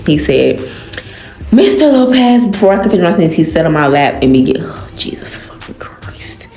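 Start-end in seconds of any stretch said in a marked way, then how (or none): 4.6-6.5 hushed, disbelieving aside; whispered aside. Real, not acted. Reader reacting to the story in a whisper. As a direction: breathe it out as a whisper. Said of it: "reader's aside".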